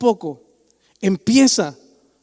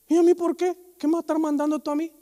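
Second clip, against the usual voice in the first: higher, fast indignant complaint; mock defiant member; 7 semitones higher, faster than usual. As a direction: imitate a churchgoer refusing orders. Church member defying a leader. (miembro rebelde indignado)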